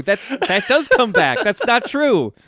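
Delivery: real high-pitched, laughing objection; excited protest. Real, not acted. Cohost objecting to the claim about animal souls, excited protest.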